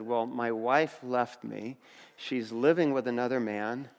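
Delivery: low, soft, slowed sad admission; retold heavy-hearted disclosure; subdued confession. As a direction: confide painful news quietly. Friend confides his wife left.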